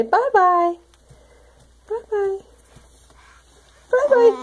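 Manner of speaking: high slow sing-song goodbye; high sing-song bye-bye. Real, not acted. Mother to baby, waving goodbye.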